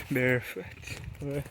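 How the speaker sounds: ordinary delivery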